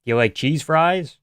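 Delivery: ordinary delivery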